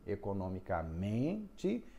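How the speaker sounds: economicamente slow, syllables laid out, men stressed. Shown as a demonstration. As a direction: draw out economicamente and land on men. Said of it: "a tônica vai ser em men".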